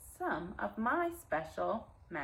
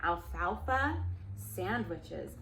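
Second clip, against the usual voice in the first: plain reading voice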